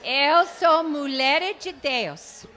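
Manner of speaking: loud, slow Portuguese with American accent; English accent Portuguese. Real, not acted. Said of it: "diga pra moça do seu lado".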